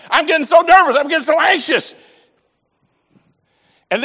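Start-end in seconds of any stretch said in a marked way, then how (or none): 0.1-2.0 higher and louder, anxiously reliving the moment; panicky, voice up, loud; nervous panic. Retold or imitated. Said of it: "I'm getting so frustrated".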